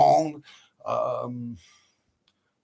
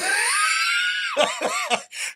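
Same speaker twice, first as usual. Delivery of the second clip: high shrieking laugh. Real, not acted. Laughs after the taunt.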